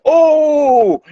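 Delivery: a sudden high, loud "Oh!"; mock exclamation, high and loud; shocked cry. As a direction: shout out in shock as the punch lands. Hutch reacts to the punch.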